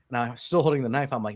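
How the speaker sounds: plain reading voice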